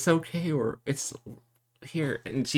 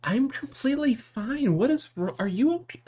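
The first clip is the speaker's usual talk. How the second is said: much higher, puzzled and worried; bewildered concern; 4 dB louder than usual. Acted. Sister to her frantic brother, bewildered and much higher. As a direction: turn the worry back on him.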